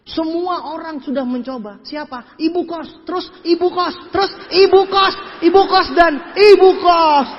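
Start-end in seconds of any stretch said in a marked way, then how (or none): 2.4-7.3 IBU KOS hit higher and louder each time; from brisk "ibu kos" to loud, stretched "ibu kos"; building emphasis. Shown as a demonstration. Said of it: "beda tekanan intonasinya".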